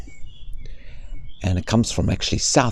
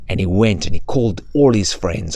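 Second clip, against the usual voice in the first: ordinary delivery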